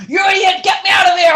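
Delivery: high, loud mock rage; angry yell. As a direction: shout it from the car boot. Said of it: "He's like".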